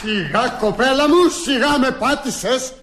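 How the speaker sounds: high, slow, drawn-out complaint; loud protest. Acted. Young master trodden on by the new maid, loudly protesting. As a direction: cry out indignantly, stretching the words.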